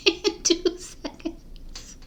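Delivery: breathy laugh then whispered words. Real, not acted. Vlogger laughing at losing focus, whispering. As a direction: laugh it off under your breath.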